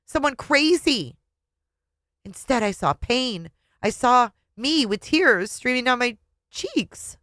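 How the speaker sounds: usual speaking voice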